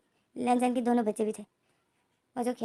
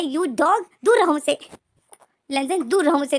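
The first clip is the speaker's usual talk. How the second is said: higher and much louder, pushing away; angry yell; 4 semitones higher, 6 dB louder than usual. Acted. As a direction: yell at him to back off. Pushing away a biting partner. (ऊँची आवाज़ में चिल्लाना)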